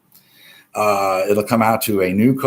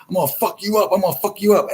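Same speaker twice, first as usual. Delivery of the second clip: high, mocking voice of an aggressive guy; mock threatening dude; 10 semitones higher, faster than usual. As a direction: play a bus bully threatening, pitched way up.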